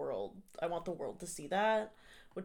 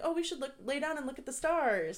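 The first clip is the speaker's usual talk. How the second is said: higher-pitched hopeful coaxing; retold eager suggestion; 7 semitones higher than usual. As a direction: lightly act out your younger, eager self. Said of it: "And I was like".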